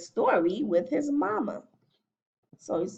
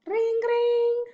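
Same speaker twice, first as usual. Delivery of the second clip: high, sustained imitated ring; phone ringing; 4 dB louder than usual. A narrator's reading. Mama llama hears the phone, imitated ringing. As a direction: trill it like a telephone. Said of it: "Mama Llama hears the phone".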